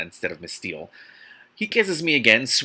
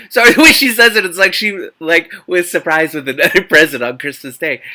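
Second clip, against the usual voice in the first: higher, louder giggly aside; laughing; 7 semitones higher, 12 dB louder than usual. Real, not acted. Reader cracking up at the heroine's delighted reaction. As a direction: laugh through it at the silly line.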